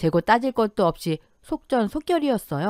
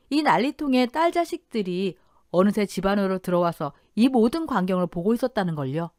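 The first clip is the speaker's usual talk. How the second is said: plain reading voice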